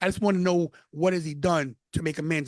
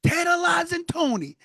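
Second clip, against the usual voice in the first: slow, much higher shouted name; booming shout-out; Mark Henry greeting; 11 semitones higher than usual. Retold or imitated. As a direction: belt the name across the room. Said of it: "He goes".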